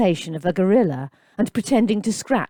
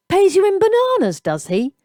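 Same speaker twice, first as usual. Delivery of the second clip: high, loud mocking jab; taunting jeer; 13 semitones higher, 5 dB louder than usual. A narrator's reading. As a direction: sneer it at the boy. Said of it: "the woman joined in".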